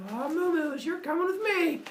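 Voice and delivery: put-on very high gleeful baby; bossy toddler claiming cows; baby Hermes.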